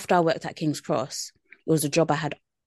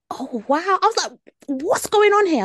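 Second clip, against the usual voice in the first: much higher, alarmed retelling of the moment; retold scared reaction; 11 semitones higher, 4 dB louder than usual. Woman retelling a stranger's reading, mock scared. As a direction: replay the startled shock, pitched way up. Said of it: "I was scared".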